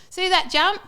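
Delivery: loud, high shouted question; calling out. Retold or imitated. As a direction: call it out over the engines. Calling out in retold poem.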